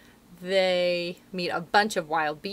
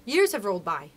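usual speaking voice

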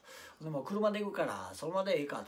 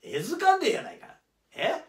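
indignant; 9 semitones higher, 7 dB louder than usual. Acted. Reacting to being breathalysed.

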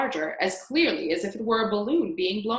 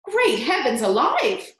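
amazed yelling; 3 semitones higher, 6 dB louder than usual. A narrator's reading. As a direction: yell in disbelief, stretching the words. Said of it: "Aunt Spiker yelled".

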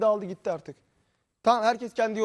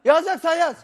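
loud, higher angry yell; shouting match; 6 semitones higher, 6 dB louder than usual. Real, not acted. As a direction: bark it at him in a rage. Repeats the shout.

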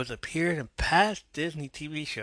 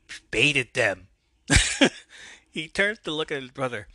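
plain reading voice

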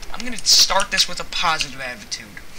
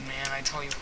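ordinary talk, nothing marked

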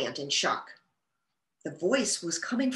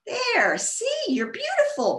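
cried out happily. A narrator's reading. Bella delighted with the opened bluebell, excited cry. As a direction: cheer it like a delighted bunny. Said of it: "Bella cried".

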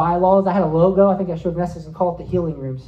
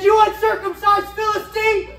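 much higher, ringing war cry at the bear; triumphant battle cry. Retold or imitated. Preacher acting out the dream fight, triumphant battle cry. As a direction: fling the insult like David at Goliath.

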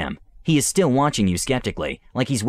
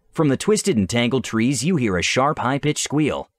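usual speaking voice

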